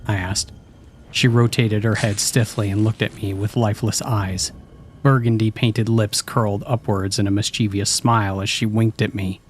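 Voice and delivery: plain reading voice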